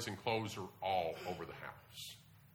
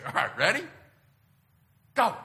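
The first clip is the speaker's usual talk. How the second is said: loud, high ready-and-go call; starting a race; 11 semitones higher, 13 dB louder than usual. Acted. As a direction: launch the kids' game with a punchy shout. Parent starts the timed game.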